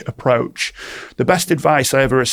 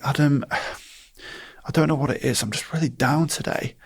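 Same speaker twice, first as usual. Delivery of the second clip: downcast client; 2 semitones higher, 5 dB quieter than usual. Retold or imitated. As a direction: sigh it out like a down client. Low client voiced in example.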